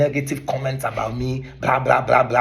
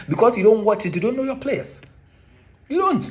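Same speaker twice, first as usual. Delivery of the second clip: rising indignation; 8 semitones higher than usual. Real, not acted. Vlogger scolds MPs for ignoring local football, rising indignation. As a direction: pitch climbing higher and higher. Building rant against MPs.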